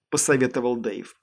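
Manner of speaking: usual speaking voice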